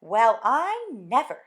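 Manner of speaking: slow, loud wondering; marvel, truly impressed. Acted. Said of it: "very much impressed".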